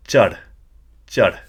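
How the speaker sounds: "chad" said short and firm with a slight H; extra breath. Shown as a demonstration. Teacher showing the Punjabi word for climb, breath-pushed "chad". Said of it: "extra breath at the end or during the word".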